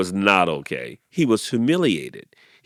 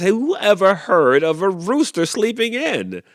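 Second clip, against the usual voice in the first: high, louder sneer; mocking sheep; 11 semitones higher, 7 dB louder than usual. A narrator's reading. Sheep mocking the sleepy rooster, high scoffing.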